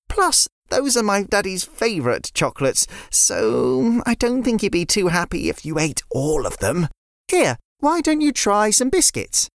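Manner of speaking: put-on a girl offering biscuits instead; little girl Layla. Layla voiced by narrator.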